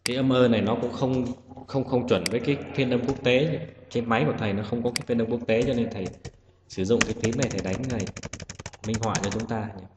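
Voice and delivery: normal talking voice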